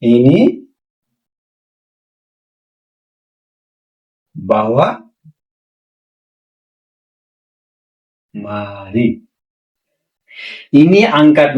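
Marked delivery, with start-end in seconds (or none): 0.0-9.1 slow dictation. Shown as a demonstration. Teacher spacing out the phrase for note-taking. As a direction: lower and softer, long gaps between words. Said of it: "dictating phrase word by word".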